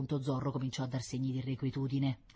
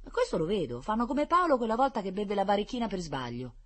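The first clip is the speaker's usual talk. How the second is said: put-on cheeky girl; 7 semitones higher, 3 dB louder than usual. Girl answers nurses.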